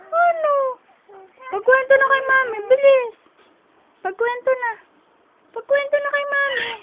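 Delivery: high eager coaxing voice; high baby talk. Acted. Mother coaxing her baby to tell mommy. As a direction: urge the baby on in a squeaky voice.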